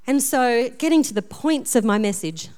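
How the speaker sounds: ordinary talk, nothing marked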